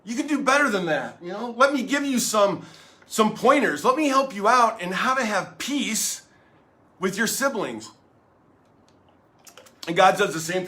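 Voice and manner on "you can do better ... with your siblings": higher-pitched parental plea; retold pleading with squabbling kids; heartbroken parent. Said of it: "it'd break my heart".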